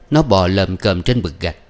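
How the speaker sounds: ordinary delivery, nothing marked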